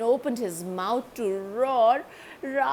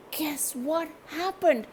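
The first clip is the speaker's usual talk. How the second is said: usual speaking voice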